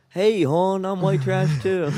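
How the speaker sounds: high, drawn-out Baltimore hon voice; mock Baltimore local, loud and slow; hammed-up Baltimore accent. Puts on a local character.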